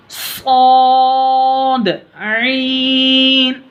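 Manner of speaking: each letter name stretched long and sung out; drawn-out letter recitation. Shown as a demonstration. Teacher showing pupils how to recite letters, long and drawn out. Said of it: "teacher reciting letter names".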